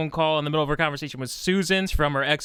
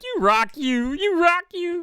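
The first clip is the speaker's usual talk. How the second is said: excited; 9 semitones higher, slower than usual. Retold or imitated. Acted quote rising.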